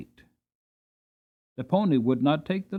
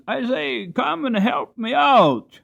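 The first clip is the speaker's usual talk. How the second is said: put-on boy calling for help; 10 semitones higher, 4 dB louder, slower than usual. Boy calls from hedge.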